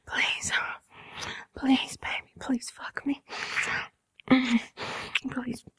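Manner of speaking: begging whisper. Acted. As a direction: beg in a fading whisper. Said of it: "I'm gonna beg you".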